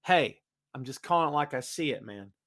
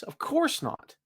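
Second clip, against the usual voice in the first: high mock; 13 semitones higher, 4 dB quieter, slower than usual. Real, not acted. Mocking dismissal.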